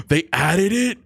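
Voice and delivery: slow, high, astonished question; gasp, then draw it out in disbelief. Real, not acted. Streamer learning of a game update, stunned.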